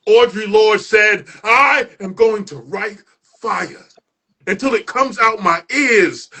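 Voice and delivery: building, rhythmic spoken-word delivery; spoken word performance. Acted. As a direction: perform it like a poem slam, rising toward the shouts.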